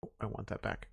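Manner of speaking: whispering. Real, not acted. Whispered aside while playing.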